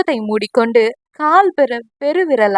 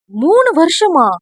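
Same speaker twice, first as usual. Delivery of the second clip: indignant surprise; 5 semitones higher, slower than usual. Acted. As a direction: throw the words back at him, hurt. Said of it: "என்று கோபமாக கூறவும்".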